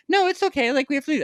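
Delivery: high, chirpy mock cashier voice; the overly friendly cashier won't let him leave, high mock voice; imitating cashier. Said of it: "And she was like".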